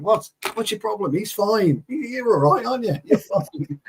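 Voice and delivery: high-pitched, playful "nothing wrong here"; mock innocent reassurance. Acting out bad cop's reassurance.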